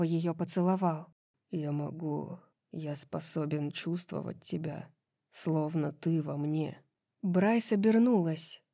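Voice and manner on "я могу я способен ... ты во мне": low, hushed confession; soft, low lover's voice; intimate murmur. A narrator's reading. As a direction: murmur it softly, low, in wonder.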